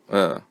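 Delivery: "uh" as a short isolated neutral vowel; schwa sound. Shown as a demonstration. Teacher reminding learners of the schwa, isolated sound. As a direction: give a lone, relaxed "uh". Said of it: "the schwa sound sounds like".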